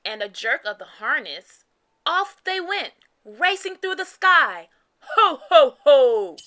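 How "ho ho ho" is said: loud jolly Santa ho ho ho; belly-laugh it like Santa. A narrator's reading. Santa laughing as the sleigh races off, loud and jolly. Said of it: "laughed Santa".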